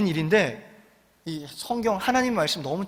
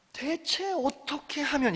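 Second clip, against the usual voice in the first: softer, slower earnest; 6 semitones higher, 9 dB quieter, slower than usual. Shown as a demonstration. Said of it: "조금만 더 간절하게".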